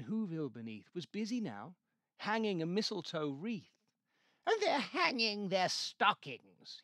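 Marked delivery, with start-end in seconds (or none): 4.4-6.8 slow sneering snarl, higher and louder; Grinch snarl. A narrator's reading. Grinch sneering at the Whos' stockings, slow snarl. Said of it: "he snarled with a sneer".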